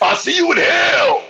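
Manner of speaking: very high, drawn-out mock scream; hammed-up villain yell. Comic signing off the show, high theatrical screech. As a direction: shriek it like a metal villain.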